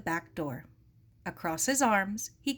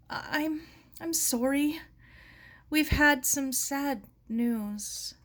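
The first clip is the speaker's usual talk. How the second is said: higher, slow, faltering sorry; halting apology; 7 semitones higher, slower than usual. A narrator's reading. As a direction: stumble over the words, trailing off. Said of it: "Her voice trailed off".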